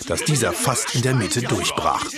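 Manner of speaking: usual speaking voice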